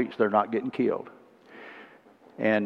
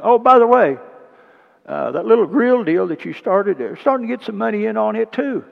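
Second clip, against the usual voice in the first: high, loud gleeful reveal; spring the good news gleefully; delighted surprise; 11 semitones higher, 9 dB louder than usual. Retold or imitated. Banker telling George about grill money, high and gleeful.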